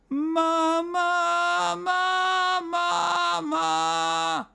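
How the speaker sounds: high sung ma, squeezed and gritty, shouty; strained, shouted high ma; excess squeeze. Shown as a demonstration. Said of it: "if I emphasize that arytenoid squeeze".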